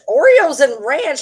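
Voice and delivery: appalled echo; disbelieving repeat. Real, not acted. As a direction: stretch it out in horror. Repeats louder, slower.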